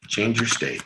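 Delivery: quiet. Shown as a demonstration.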